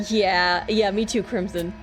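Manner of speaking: normal talking voice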